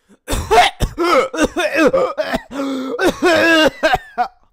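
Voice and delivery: long, violent coughing fit with wheezes. Pretend. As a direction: hack and wheeze as loudly as possible. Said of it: "Make the cough louder".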